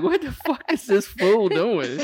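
passersby staring at the recruit, laughing; laughing bystanders. Retold or imitated. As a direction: ask it slowly while laughing. Onlookers' thought, laughing.

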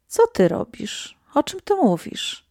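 puzzled, rising disbelief; astonished questioning. A narrator's reading. Daughter finds her mother praying over candles, astonished. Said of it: "odezwałam się zdumiona".

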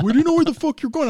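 shouting back, baffled and defensive; indignant friends. Retold or imitated. Friends shouting back at the dead end, loud and high. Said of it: "And they were like".

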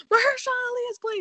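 high, loud, thrilled announcement; excited screaming. Retold or imitated. Bursting into her sister's room with the news, a high excited shout. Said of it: "I literally started screaming".

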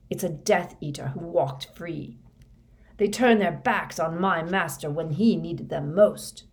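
forceful, bitter tirade with loud bursts; vengeful rant. Acted. Moody vents hatred of traitors to Harry, vengeful. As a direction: hammer out the grievance with fervor.

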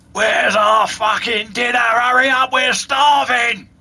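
loud, high, slow roaring orc shout. Acted. Hungry orc yelling for dinner, loud and high. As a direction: bellow it like a starving orc. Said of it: "an orc voice coming from down the passageway saying".

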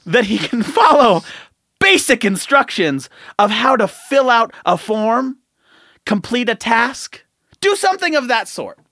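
loud, high exasperated ranting; rant, loud and high, slowing down; heated rant. Real, not acted. Heated rant about builders.

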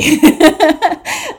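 high, loud giggle; amused laugh. Real, not acted. Laughing at own take on a lost volunteer, amused. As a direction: burst out laughing.

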